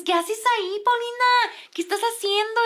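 high-pitched worried scolding; alarmed questioning. Retold or imitated. Friend asking Paulina what she's doing, imitated alarm. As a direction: imitate urgent, incredulous texts. Acted alarmed messages to narrator.

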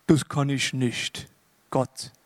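low, slow, dragging refusal; mock-reluctant, low and drawn out; reluctant excuse. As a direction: drag out a weary excuse to God. Acting out the Bible's favourite excuse.

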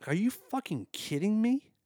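can't believe what he just read; high incredulous exclamation; shocked disbelief. Real, not acted. Reacting to a shocking quote.

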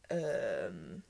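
usual speaking voice

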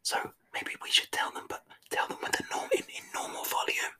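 breathy whisper. Pretend. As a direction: whisper back quietly. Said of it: "everybody has to whisper back".

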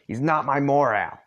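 loud insistent shout; shouted denial. Acted. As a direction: yell it back at the boys. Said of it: "You and Solix shout".

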